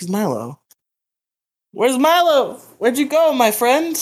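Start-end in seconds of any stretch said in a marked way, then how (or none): 1.7-4.0 calling out. Real, not acted. Searching for lost Milo, calling.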